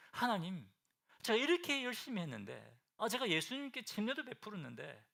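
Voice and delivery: a hint of a hurt, complaining plea; resentful complaint to God. Preacher voicing John the Baptist grumbling to God, mildly resentful. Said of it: "이렇게 막 원망했습니까".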